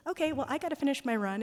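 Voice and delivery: high, loud and fast, quick exit; hurried brush-off. Retold or imitated. Retelling the jogger's hasty goodbye as he leaves, a high fast brush-off. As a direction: rush it out to end the moment. Jogger hurrying off after crying.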